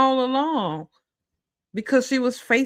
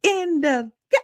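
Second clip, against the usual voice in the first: talking to Tyra; 4 semitones higher than usual. Retold or imitated. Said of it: "I'm like".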